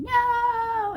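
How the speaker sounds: high screamed no; retold scream. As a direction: scream it out in shock and grief. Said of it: "she was screaming".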